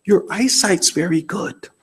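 softly spoken, measured husband's reply; very quiet. Retold or imitated. Husband answering his wife's plea for compliments, very quietly. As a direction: say it slowly, under your voice. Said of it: "he said very quietly".

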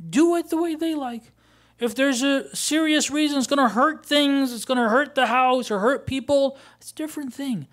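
high, loud, exasperated sermon voice; impassioned preaching. Acted. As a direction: preach it emphatically, pitched right up. Preaches at full force.

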